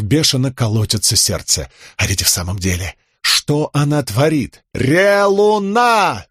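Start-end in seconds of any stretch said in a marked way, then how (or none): 4.7-6.3 протяжно, высоко выкрикнуть; крикнуть во весь голос, медленно растягивая. A narrator's reading. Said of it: "заорала она в ответ Талику". (Рисн орёт в ответ Талику, протяжно)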